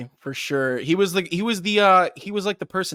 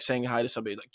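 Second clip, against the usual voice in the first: ordinary delivery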